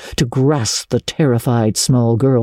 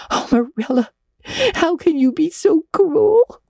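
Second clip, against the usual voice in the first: much higher, pleading half-sob; sobbing. A narrator's reading. Anne begging Marilla not to send her, tearful plea. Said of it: "sobbed Anne".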